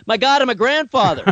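high, loud exclaim of disbelief; sudden realization, half laughing; surprised. Real, not acted. Realizes he is a grandfather.

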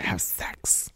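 hushed whisper on sex. Acted. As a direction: drop to a whisper. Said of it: "Why are we whispering?".

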